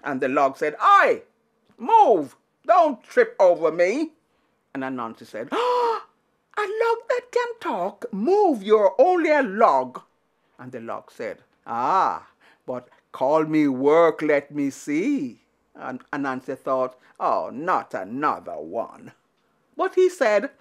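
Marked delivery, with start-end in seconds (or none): 0.8-4.1 louder, gruff complaint; grumpy log. Acted. Log telling off Anansi for tripping, loud. 5.5-10.0 squeaky high then dropping scoff; mock the pot in a high spider voice; scoffing Anansi. Anansi scoffing at a talking pot, squeaky. 11.7-15.4 lower, slow, deliberate pot voice; slow magic pot. Acted. Magic pot answering Anansi, slow and low. 17.2-19.1 weary groan. Acted. Anansi groans to himself.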